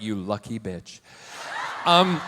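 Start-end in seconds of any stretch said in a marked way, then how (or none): 0.0-1.0 low, soft muttered aside; a quiet catty grumble, much lower; envious aside. Retold or imitated. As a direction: mutter it under your breath with envy. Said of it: "I was like".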